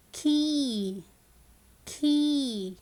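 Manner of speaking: "key" drawn out as "keee"; slow phonics model of "key"; long E in key. Shown as a demonstration. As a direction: hold the ee in "key". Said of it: "It is the long sound".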